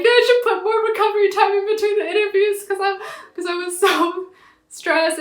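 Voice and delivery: a high, loud near-sob; tearful wail. Retold or imitated. Retelling near tears to interviewer.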